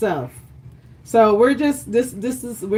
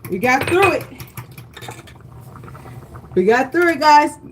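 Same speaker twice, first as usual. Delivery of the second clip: ordinary talk, nothing marked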